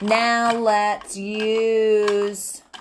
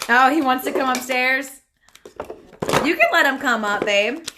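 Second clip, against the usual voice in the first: calling out; 7 semitones higher, 6 dB louder than usual. Real, not acted. Calling to partner in another room.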